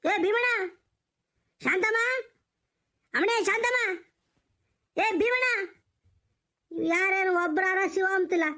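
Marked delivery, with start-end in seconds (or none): 0.1-5.7 calling out. Acted. Old man calling Bheemanna and Shanthamma from outside, raised calling voice. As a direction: call the names out to someone far off.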